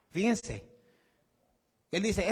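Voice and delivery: normal talking voice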